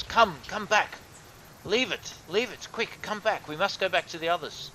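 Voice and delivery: high, urgent call to come back; Savid calling back. Acted. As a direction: call them back in a hurry, pitched high.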